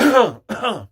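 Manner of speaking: high, loud throat-clear; exaggerated throat-clearing; loud fake cough. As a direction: force out a noisy staged cough. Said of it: "like, cough loud".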